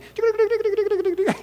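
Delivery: a burst of high laughter; high squeaky laugh. Real, not acted. Preacher laughing at his own Martha voice, high giggle.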